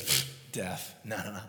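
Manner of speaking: low, soft scoff; dismissive. Retold or imitated. Jesus brushing off death, quietly dismissive.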